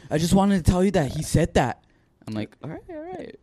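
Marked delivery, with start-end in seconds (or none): 0.1-1.8 higher-pitched earnest explanation; a hint of an insistent kid; lightly voiced little girl. Girl explaining her tattling, light kid voice. 2.6-3.4 soft, high hushing reply; placating. Retold or imitated. Coach soothing the tattletale, soft placating voice.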